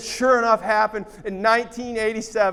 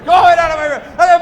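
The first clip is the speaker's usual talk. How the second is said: ordinary talk, nothing marked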